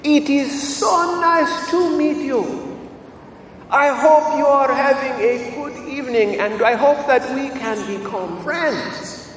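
put-on high-pitched, delightful Kenyan accent; slow, friendly greeting to a little boy in a Kenyan accent; Father Joseph, Kenyan accent. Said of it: "in the most delightful Kenyan accent".

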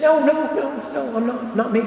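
a man panicking as he is recognised; frightened denial. A narrator's reading. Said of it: "struck to the heart with fear".

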